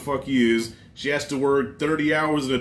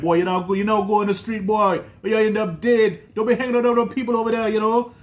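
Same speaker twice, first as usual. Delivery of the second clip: put-on Jamaican mom accent; 7 semitones higher than usual. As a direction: scold him like a Jamaican mama, pitched up and rushing at the end. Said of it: "with her Jamaican accent".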